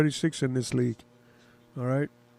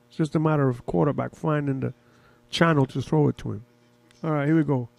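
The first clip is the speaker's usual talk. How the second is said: ordinary delivery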